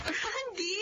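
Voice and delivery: high tearful cry of joy; crying out his name. Acted. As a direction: sob his name overwhelmed. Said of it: "bakit ka umiiyak?". (umiiyak na sigaw sa tuwa)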